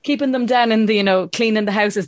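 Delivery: ordinary delivery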